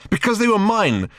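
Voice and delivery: high, cross retort; crossly. Acted. Ford defends the self-sacrifice of his shoes, cross retort. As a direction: answer in a huff. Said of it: "said Ford crossly".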